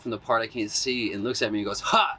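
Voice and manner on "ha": sudden high "ha!"; punchy high burst, mimicking the drummer; imitated drummer's shout. Drummer popping out to startle the dancer, sudden high "ha!".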